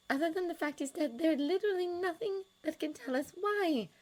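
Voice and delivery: Miss Hooper voice. A narrator's reading.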